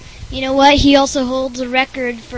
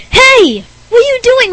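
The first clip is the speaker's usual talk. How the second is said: high, louder cry of alarm; startled call-out; 13 semitones higher, 10 dB louder than usual. Acted. Host catching Rick Berner climbing in, startled call-out. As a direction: challenge an intruder in a high startled voice.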